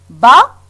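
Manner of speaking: "ba" as a lone, loud syllable. Shown as a demonstration. Teacher splits a word for pupils, "ba" as a separate syllable. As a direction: sound out "ba" on its own, firmly. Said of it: "en combien de syllabes".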